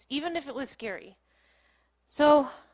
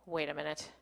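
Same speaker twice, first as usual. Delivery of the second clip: low, soft voice to herself; hushed, lower retold thought; dawning realization; 7 semitones lower, 8 dB quieter than usual. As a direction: say it softly as it dawns on you.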